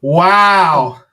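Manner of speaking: loud, drawn-out wow; real amazement, stretched out loud; astonished. Real, not acted. Listener reacting to a savage roast, astonished.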